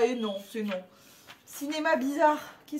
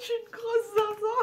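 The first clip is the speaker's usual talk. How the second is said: squeaky, tearful laughing words; barely able to speak from laughing; crying with laughter; 11 semitones higher than usual. Real, not acted. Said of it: "j'en pleure de rire".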